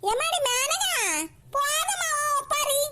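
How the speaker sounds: teasing. Acted. Grandmother teasing Menaka about her endless wailing, slow and mocking.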